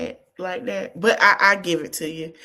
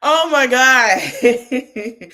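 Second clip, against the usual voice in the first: laughing; 3 semitones higher, 7 dB louder, much slower than usual. Real, not acted. Laughing at the drama.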